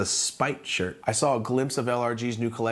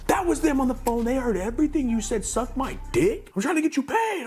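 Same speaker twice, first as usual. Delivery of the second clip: very high-pitched frantic retold rant; mock yelling. Friend scolding him for what the caller heard, mock high-pitched yell. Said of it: "he comes in yelling at me".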